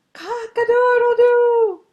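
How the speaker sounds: high, very loud drawn-out crow. A narrator's reading. Rooster trying to crow again, loud and high. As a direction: crow out loudly, stretching it. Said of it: "And then again louder".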